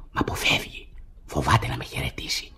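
low, hushed whisper; suspicious whispered aside. Acted. Hidden, watching stranger.

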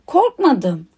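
plain reading voice

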